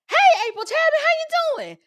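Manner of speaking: very high, loud sing-out hello; gushing greeting. Retold or imitated. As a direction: imitate an overjoyed agent squealing a greeting. Agent greets her after security.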